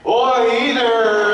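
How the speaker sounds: high, loud, slow mock Texan; bad Texan accent.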